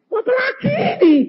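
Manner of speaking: high, loud protest to God; wailed, half-laughing complaint; exasperated outcry. Retold or imitated. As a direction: cry it out in high-pitched exasperation. Preacher retells loud protest to God.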